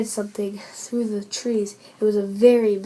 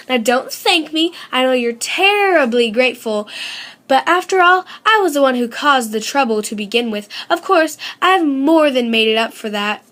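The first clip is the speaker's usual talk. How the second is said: boastful Goldie; 2 semitones higher, 7 dB louder than usual. A narrator's reading.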